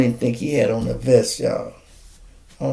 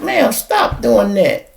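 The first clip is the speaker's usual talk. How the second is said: imitating the cop; 9 semitones higher, 4 dB louder, slower than usual. Cop ordering her to stop.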